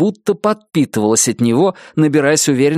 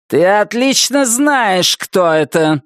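higher, slow, taunting menace; menacing caller; 7 semitones higher, slower than usual. Acted. Wilma taunting Nettie on the phone, slow menace. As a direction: drag out the taunt. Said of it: "сказал голос в трубке".

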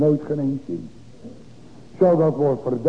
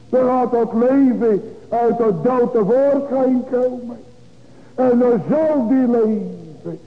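raised, high, fervent pulpit voice; full-force preaching; 7 semitones higher than usual. Acted. Preacher lifting his voice to the congregation, fervent.

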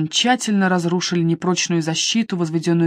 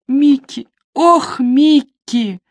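slow, higher wail of grief; a wife wailing her dead husband's name; crying out; 6 semitones higher, 5 dB louder, much slower than usual. A narrator's reading. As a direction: drag out the name in despair. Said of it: "Она заплакала".

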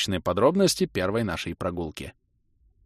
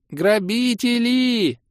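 much higher, slow, furious woman's cry; thin, very angry woman's voice; 4 dB louder, much slower than usual. Acted. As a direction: cry thief like an enraged shopkeeper. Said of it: "Тонкий, но крайне злой женский голос".

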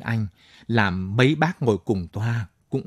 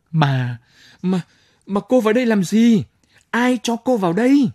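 high, loud flustered scolding; stammering anger; 9 semitones higher, 4 dB louder than usual. Acted. Commander sputtering angrily at the soldier's wife, high and loud. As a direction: sputter then demand angrily.

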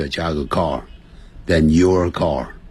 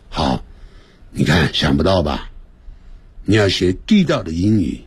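ordinary talk, nothing marked

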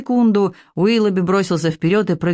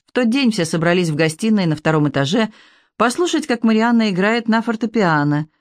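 usual speaking voice

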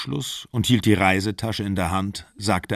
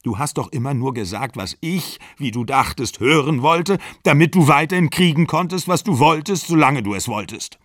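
bitter accusation; 6 semitones higher, 4 dB louder than usual. A narrator's reading. Exler accuses her bitterly.

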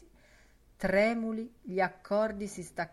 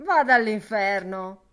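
much louder brush-off; dismissive; 2 semitones higher, 12 dB louder than usual. Acted. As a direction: tell him off as you walk out. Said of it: "disse Blazes Boylan andandosene".

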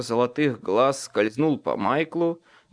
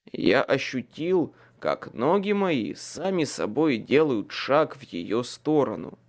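ordinary delivery

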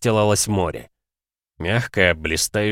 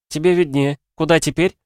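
higher, lighter woman's voice; female character voice; 9 semitones higher, 4 dB louder than usual. Acted.

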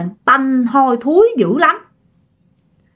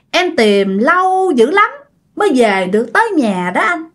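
creepy ghost woman; 2 semitones higher than usual. Acted. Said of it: "Nói vậy đó các bạn".

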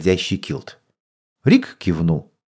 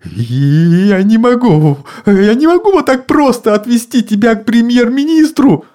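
mumbling; 12 semitones higher, 4 dB louder than usual. A narrator's reading. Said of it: "забормотал мистер Уилкс".

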